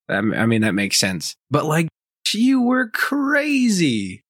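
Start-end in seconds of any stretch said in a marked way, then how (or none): 2.3-4.2 much higher, very slow "you were crazy"; drawn-out mocking. Real, not acted. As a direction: stretch it out in disbelief. Mocking the lady's behaviour.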